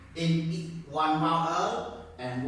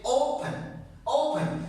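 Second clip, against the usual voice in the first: "open" with a strong, higher O; stress on first syllable; 7 semitones higher than usual. Shown as a demonstration. Teacher repeating the word to show the stronger syllable, "open" stressed on the O. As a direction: hit the O of "open" each time. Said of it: "first syllable is stronger".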